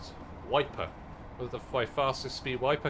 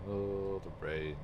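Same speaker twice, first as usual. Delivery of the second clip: normal talking voice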